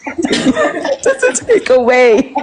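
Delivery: squeaky high, helpless laughter through the words; laughing. Real, not acted. Speaker losing it mid-point to her listeners, laughing. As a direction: try to get the words out while cracking up. Breaks up laughing while repeating herself.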